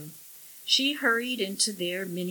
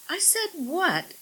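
incredulous; 11 semitones higher than usual. Acted. Wife incredulous.

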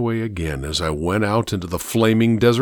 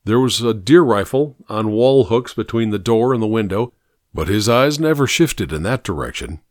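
usual speaking voice

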